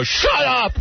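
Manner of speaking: high-pitched mock outburst; yelling father. Father answering "how was work?", mock-furious yell. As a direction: cut them off, imitating an enraged dad. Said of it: "Usually he just yelled".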